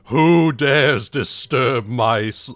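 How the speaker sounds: slow booming ancient beast; dragon voice. Acted. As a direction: rumble it out like a waking dragon. Mock ominous intro then breaks off.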